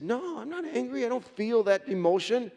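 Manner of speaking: high-pitched, mock-defensive husband; protesting denial. Husband denying to his wife that he's angry, high defensive protest. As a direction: insist on it, pitch climbing as you protest.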